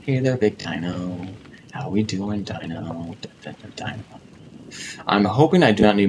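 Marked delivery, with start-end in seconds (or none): 0.1-3.2 slow and soft, fading down to almost nothing; hushed sneaky greeting. Real, not acted. To a big dino while sneaking close, softer and softer. 3.2-4.2 breathy whispered dun dun dun; whispered suspense tune. Pretend. Sneaking toward the dino, whispered suspense jingle.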